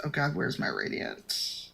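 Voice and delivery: ordinary delivery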